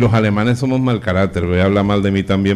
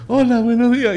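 high-pitched, sing-out good morning; comic high bride voice; bride's morning greeting; 12 semitones higher than usual. Acted. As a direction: chirp a newlywed's good morning. Bride greets new husband in bed.

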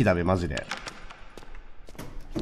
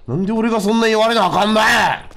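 high, loud mock outrage in Kansai dialect; indignant shout; 11 semitones higher, 6 dB louder than usual. Player voicing the scolded worker, a hammed-up high indignant shout. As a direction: blow up in hammed-up protest.

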